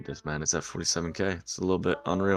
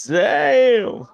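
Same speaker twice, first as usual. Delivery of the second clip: very high, loud squeaky "Damn"; cute falsetto damn; 10 dB louder than usual. Retold or imitated. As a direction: squeal it like a cute girl would find funny. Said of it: "They thought it was cute when I said".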